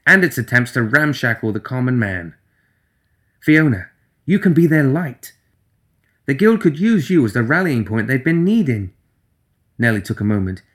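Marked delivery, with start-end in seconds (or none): 3.4-8.9 higher, eager voice; earnest, bright-eyed persuasion; Nelly's voice. Acted.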